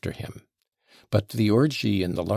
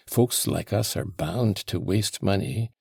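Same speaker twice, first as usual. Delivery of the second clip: plain reading voice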